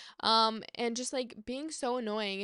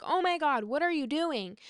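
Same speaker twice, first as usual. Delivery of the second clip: higher, louder mock yell; alarmed outburst, pitched up; retold yell; 5 semitones higher, 4 dB louder than usual. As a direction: imitate yelling at the driver. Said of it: "I yelled at him".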